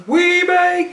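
"We back" pitched high and punchy, announcer hype; "We back" thrown up high with energy; hyped "We back". Shown as a demonstration. Trying out the intro line.

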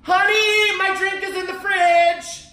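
high, loud call to another room. Pretend. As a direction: shout it across the house. Calls to someone in the house.

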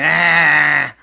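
loud mock groan of dismay. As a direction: groan like a grumpy grandpa. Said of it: "I was like".

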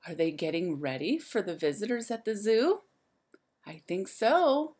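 ordinary delivery, nothing marked

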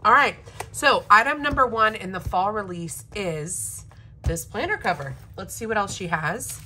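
usual speaking voice

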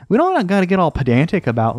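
ordinary talk

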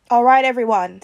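rally the team; commanding leader. A narrator's reading. Steve leading the drill.

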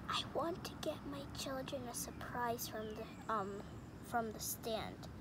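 put-on softly, so children won't hear; hushed. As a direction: keep it down. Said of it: "Hopefully they didn't hear that".

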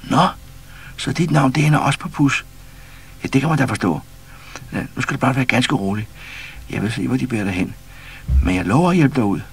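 kindly reassuring the frightened one; light character voice. A narrator's reading. Figure voiced in read story.

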